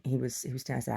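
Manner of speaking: ordinary talk, nothing marked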